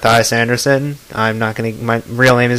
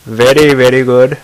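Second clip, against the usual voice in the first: put-on light Indian accent; a hint of an Indian accent; 3 dB louder than usual. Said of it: "I can do other accents".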